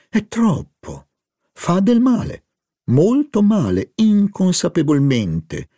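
high, fluting mock-distress; theatrical hostess. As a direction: wring your hands over the harm, voice up high. Madame Verdurin dramatizes Charlie's harm.

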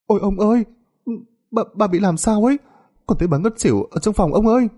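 very high, panicked servant voice; alarmed cry for help. Acted. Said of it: "Nó la ầm lên rồi hô hoán". (giọng người ở hốt hoảng hô hoán)

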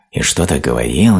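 ordinary delivery, nothing marked

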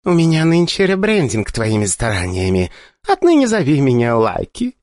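lightly voiced haughty goddess; a hint of a playful goddess. A narrator's reading. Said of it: "ответила богиня".

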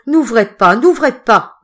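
loud, high panicked begging; loud, high frantic repeating; frightened repetition. Acted. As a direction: plead in fear not to open. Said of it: "répétait Roubion".

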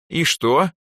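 high, bewildered little question; puzzled. A narrator's reading. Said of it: "недоумённо спросила Эльза".

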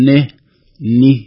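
normal talking voice

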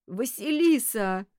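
mildly tearful wail, stretched out; sobbing call. A narrator's reading. Detective calling to the girl lying lifeless, sobbing. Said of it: "утробно всхлипнув".

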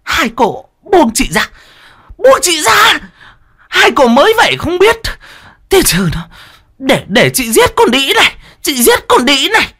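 put-on high, loud frantic scream at the girl; screaming wife. A narrator's reading. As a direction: scream it out, wild with rage. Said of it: "gào lên".

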